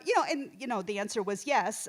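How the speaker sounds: normal talking voice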